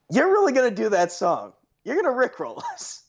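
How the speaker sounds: incredulous. Retold or imitated. Friends react to the song choice.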